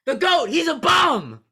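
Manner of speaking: loud, high mock yell; mocking shout. Real, not acted. Annoyed podcaster mocking his cohost's Brady cheer, loud shout. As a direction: yell it back sarcastically at him. Mock-mimicking rival's celebration.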